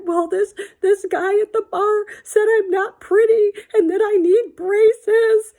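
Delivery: high, loud tearful wail, drawn out at the end; mock bawling. Girl sobbing to her dad about the insult, bawling. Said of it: "my dad saw me bawling".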